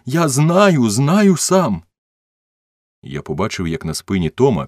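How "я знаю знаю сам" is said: high, loud insistent wail; crying out. A narrator's reading. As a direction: cry out insistently, voice way up. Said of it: "закричав він". (розпачливий крик)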